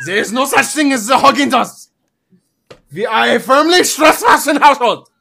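put-on high, loud German-accented rant; passionate outrage. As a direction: explode into a hammed-up German tirade. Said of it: "I get passionate".

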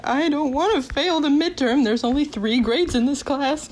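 mock verge-of-tears plea; retold near tears. Student fretting over failing the midterm, mock tearful. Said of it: "I was on the verge of tears already".

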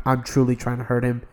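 usual speaking voice

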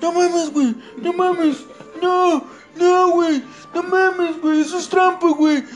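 high, loud panicked pleading; panicked yelling. Retold or imitated. Friend screaming at the horror game, high panicked pleading. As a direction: yell in terror, voice cracking into a cry. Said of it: "Y empezó a gritar".

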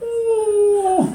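high sympathetic ooh; moms wincing. Retold or imitated. Moms reacting to the fall, high wincing ooh. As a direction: wince like a worried mom. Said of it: "all the moms are like".

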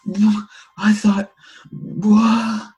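high, loud, baffled cry; shocked villager. Retold or imitated. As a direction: play a startled neighbor. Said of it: "It's like".

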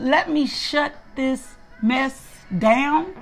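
drawn out word by word, pitch climbing at the end; slow self-command. Retold or imitated. Woman telling herself to quit social media, slow and deliberate. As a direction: spell it out slowly to yourself.

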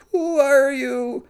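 ordinary delivery

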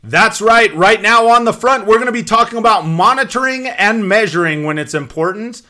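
loud pumped host open; energy drink ad. Acted. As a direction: punch it like a promo. Host re-opens after jingle.

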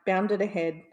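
usual speaking voice

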